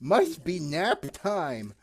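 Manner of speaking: slow, drowsy stretched words; yawning. Pretend. Sleepy character opens the show at nap time, yawning. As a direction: talk through sleepy yawns. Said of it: "I can't stop yawning".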